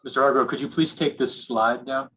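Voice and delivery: ordinary talk, nothing marked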